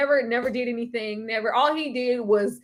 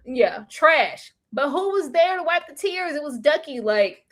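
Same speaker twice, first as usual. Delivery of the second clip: ordinary talk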